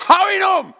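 high, full-force touchline yell; loud, high-pitched sports-parent shout; sideline dad screaming. Retold or imitated. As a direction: scream at your kid from the sidelines. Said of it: "und schreit".

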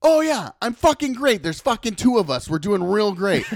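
high, loud, fed-up mock rant; sarcastic outburst. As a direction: act out a pissed worker insisting all is great. Pissed worker's sarcastic outburst.

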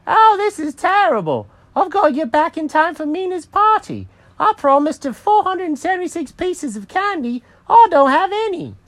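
very high, loud cartoon wail; Jenkins the cat, panicking. Cat fears missing Mina's party without candy, high panicked wail. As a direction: wail in dismay as a squeaky cat.

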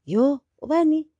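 high, loud puzzled "Yo, ubani?"; mock puzzled question. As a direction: play the confused stranger. Mimicking someone mishearing her name.

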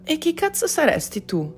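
high-pitched, challenging woman's voice; high, hostile question from a worn-out woman; confrontational woman. A narrator's reading. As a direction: demand to know who this stranger is. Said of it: "si premurò di chiedere una donna sulla trentina, secca e sciupata".

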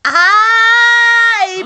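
loud high surprised cry. Real, not acted. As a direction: burst out in delight. Sudden bright realization.

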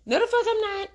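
playful high defiance; high emphatic refusal. Retold or imitated. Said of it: "she finna say".

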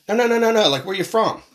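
pushy, high-pitched imitation of a questioner; insistent stranger. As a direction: imitate someone brushing off the answer and pressing. Stranger rejects answer, asks again.

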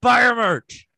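high, very loud comic demand; mock-angry shout. Podcaster ordering listeners to support the show, hammed-up shout. Berating listeners to buy merch.

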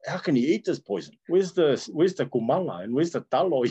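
high-pitched, indignant food complaint; acted-out dismay at strange food; bewildered younger self. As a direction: imitate your baffled younger self, pitched well up. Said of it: "I'm like".

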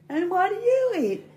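very high frog voice; wide-mouthed frog. Acted. Frog asking the snake its diet, very high voice. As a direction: squeal the question as a nosy frog. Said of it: "he goes".

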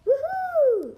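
very high, loud whoop; shout. A narrator's reading. Kyle cheering after the hologram race, shouting. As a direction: whoop with pure joy. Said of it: "shouted Kyle".

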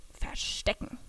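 hushed whisper while hiding. Acted. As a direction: breathe it so Bruno won't hear. Hiding behind bush from Bruno. (geflüstert beim Verstecken)

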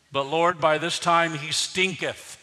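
emphatic repeat. Shown as a demonstration. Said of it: "King James Version".

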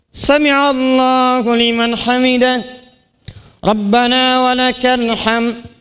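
slow chanted prayer call; slow imam-style chant; prayer recitation. Shown as a demonstration. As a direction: recite the salat phrases slowly.

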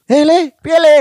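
put-on high-pitched call to a kid; retold calling out. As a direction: act out hailing a boy. Acting out someone calling a kid. (niru nyeluk bocah kanthi swara dhuwur)

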